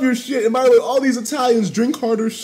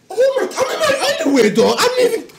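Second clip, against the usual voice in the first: excited babble; 12 semitones higher, faster than usual. Real, not acted. Reacting to huge donation.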